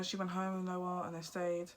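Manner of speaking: usual speaking voice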